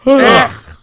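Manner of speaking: loud high cry of disgust; disgusted ugh. Acted. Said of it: "said all three engines together".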